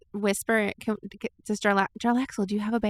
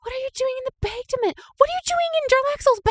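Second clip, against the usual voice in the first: very high, quick, excited squeal; astonished questioning; 3 dB louder, faster than usual. Acted. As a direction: blurt it out high in amazed disbelief. Astonished at dragon inside the bag.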